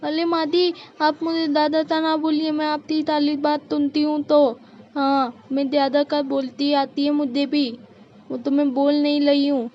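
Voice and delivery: put-on babyish lisp, mildly defiant; aggrieved bahu talking back in a lisp; lisping daughter-in-law. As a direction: protest with childlike consonants. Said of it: "इतना तोतला बोल रही हो".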